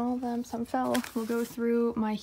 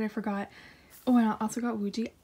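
ordinary talk

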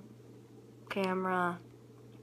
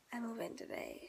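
whispering; 10 dB quieter than usual. Real, not acted. Alone at home, hushed.

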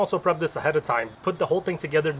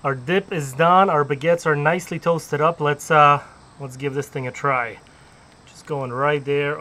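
ordinary delivery

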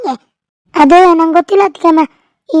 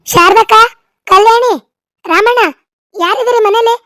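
higher-pitched call to the house, name by name; 5 semitones higher than usual. Acted. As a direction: call everyone out from the doorway. Calling into house from outside.

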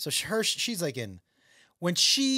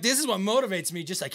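usual speaking voice

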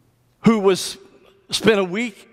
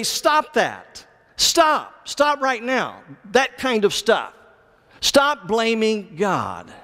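higher-pitched, punchy repeated orders; insistent command; 4 semitones higher, much slower than usual. Acted. Preacher voices James telling believers to quit blaming God, insistent command. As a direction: press each "stop" home as a firm rebuke.

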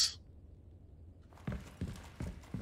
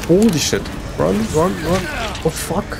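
panicked shouts while fleeing; being chased; 6 semitones higher, 4 dB louder, faster than usual. Real, not acted. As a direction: yell it in a panic, running. Chased by monster, yelling run.

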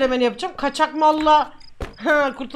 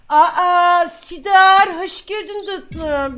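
loud surprise easing into drawn-out welcome; gushing greeting; 4 semitones higher, 6 dB louder, much slower than usual. Real, not acted. Streamer welcoming Sidar as sweetie, gushing greeting. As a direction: greet a darling, stretching the words.

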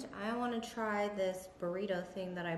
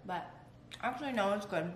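eating between words; talking while chewing. Real, not acted. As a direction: mouth partly full. Seen 1: Holds salad bowl throughout; bites tortellini at 5.6, chews after.

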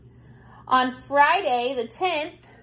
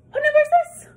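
excited; 14 semitones higher, 6 dB louder, faster than usual. Shown as a demonstration.

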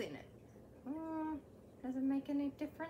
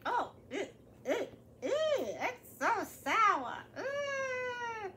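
disgusted; 7 semitones higher, slower than usual. Acted. Caterpillar tastes sour pickle.

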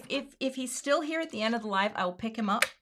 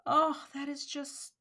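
usual speaking voice

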